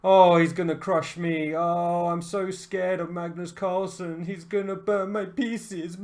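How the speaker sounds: slow, whiny mock fear; mock scared. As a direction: play the terrified underdog, hammed-up. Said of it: "that's a better mentality to have than".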